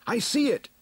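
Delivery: high, sudden shout; high cry on spotting it; shouted discovery. A narrator's reading. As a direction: shout the sighting out at high pitch. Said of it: "Then Man-at-Arms shouted".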